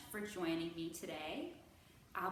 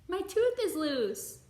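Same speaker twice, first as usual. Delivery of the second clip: put-on higher, louder, slow excited kid's announcement; excited child; 7 semitones higher, 10 dB louder, slower than usual. Ethan telling his dad about his tooth, higher excited child voice. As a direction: announce it like a thrilled little boy.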